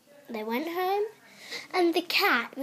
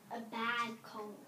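plain reading voice

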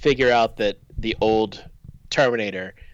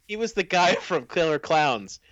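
ordinary talk

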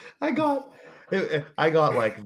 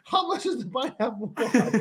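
high-pitched demand through laughter; mad dad; 12 semitones higher than usual. Retold or imitated. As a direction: imitate an irate customer, amused. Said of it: "why is he so mad".

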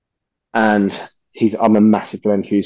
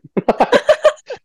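high laugh. Real, not acted. Laughs at his own story.

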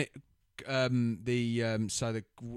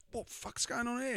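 squeaky high put-on voice; hammed-up high-pitched shout; high mock outburst. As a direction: shriek it out in mock disbelief. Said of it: "And he goes".

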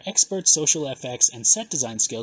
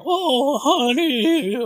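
much higher, louder hammed-up Swedish [name]; 11 semitones higher, 5 dB louder, much slower than usual. Host does the line as Swedish [name] for laughs. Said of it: "do it as maybe like Swedish [name]".

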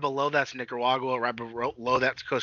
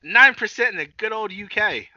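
put-on higher, louder botched British accent; attempted UK accent; 8 semitones higher, 5 dB louder than usual. Podcast host hams up listener stats for the UK, badly faked accent. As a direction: put on a bad UK accent that veers Australian. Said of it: "I'm not bad at accents".

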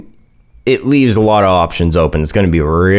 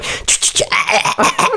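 a failed imitation of the whispered stalker noise; unvoiced ch-ch-ah done badly on purpose; girlfriend's bad copy of the spooky hiss; mock creepy hiss; 12 semitones higher, 3 dB quieter than usual. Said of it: "she just keeps mocking me, like".

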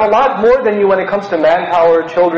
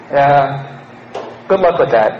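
condescending sneer; 7 semitones lower than usual. Retold or imitated. Condescending reply to loner.

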